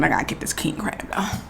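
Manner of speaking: quick unvoiced whisper. Real, not acted. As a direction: mutter it under your breath. Whispered aside while eating.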